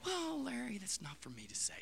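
quietly mocking a preacher's evasive answer on TV; mock meek reply.

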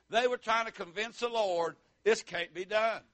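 ordinary delivery

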